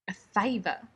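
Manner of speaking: usual speaking voice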